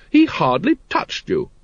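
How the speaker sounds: high, slow cheerful lady. A narrator's reading. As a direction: brush it off cheerfully. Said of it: "said Lady Ramkin cheerfully".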